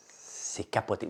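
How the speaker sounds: slow, breathy hush of awe; awed whisper. Real, not acted. Holding a waffle on a live, whispering in awe. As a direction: whispers it slowly, amazed by the waffle.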